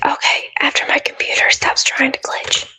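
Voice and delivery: ASMR-style whisper. Real, not acted. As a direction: whisper into the mic. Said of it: "the way that we're whispering into our mics".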